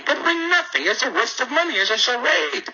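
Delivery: high, scornful exasperation; exasperated. Real, not acted. Host ranting about institutions.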